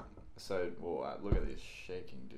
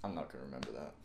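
sighed out, lower and softer; big sigh; 6 semitones lower, 7 dB quieter, much faster than usual. Real, not acted. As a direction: let it out on a heavy sigh. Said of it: "It's the second big sigh of the video".

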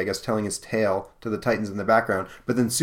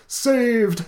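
much higher, louder relieved squeal; relieved miser; 13 semitones higher, 6 dB louder than usual. Acted. Rescued old miser cries out, high relieved squeal.

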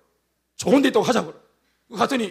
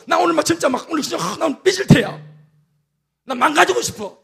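high, whiny sing-song sulk; mock sulking; 6 semitones higher than usual. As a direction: pout like a sulky child, high and sing-song. Voicing sulky self wanting to pout. (삐진 아이처럼 높고 노래하듯 투정)

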